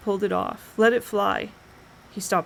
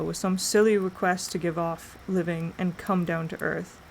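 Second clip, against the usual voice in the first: plain reading voice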